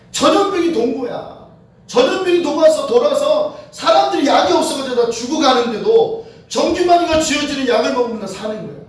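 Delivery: ordinary talk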